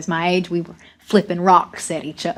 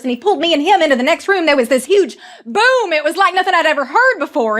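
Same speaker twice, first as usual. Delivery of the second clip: raised, high-pitched account of sudden disaster; loud, driving climax of a tale; urgent storytelling; 6 semitones higher, 4 dB louder than usual. A narrator's reading. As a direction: build the panic as the roof caves in. Telling the ceiling collapse.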